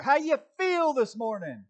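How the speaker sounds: high, loud pumped-up host voice; mock hype greeting. Pastor mocking a hyped-up church welcome, loud and high. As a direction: hype the crowd like a show host.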